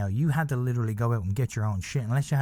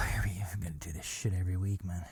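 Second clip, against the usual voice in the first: whispered, softer, fed up with himself; exasperated whisper; 5 semitones lower, 8 dB quieter than usual. Real, not acted. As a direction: sigh and whisper it to yourself. Exasperated aside after sigh.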